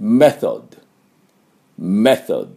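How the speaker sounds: English-style メソッド, no vowel after the final d; model English pronunciation. Shown as a demonstration. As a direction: say メソッド for students to repeat, English th and light d. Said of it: "私の後について発音いきましょ".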